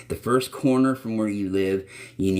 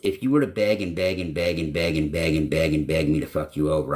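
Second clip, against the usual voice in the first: usual speaking voice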